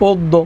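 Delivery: অদ্য as oddo with a doubled d. Shown as a demonstration. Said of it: "কি উচ্চারণ হবে?". (অদ্য উচ্চারণ অদ্দো)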